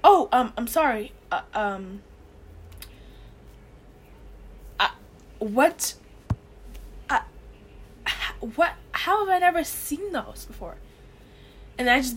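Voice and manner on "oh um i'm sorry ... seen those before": stammering, halting apology rising into a baffled question; lightly acted awkward stammer with long pauses; flustered Luke Skywalker. Luke Skywalker walking in on her by accident, flustered. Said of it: "then he'd be like".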